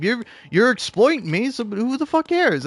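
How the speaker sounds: high, scoffing retort; high sarcastic shrug; defiant scoff. Retold or imitated. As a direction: throw it back at him.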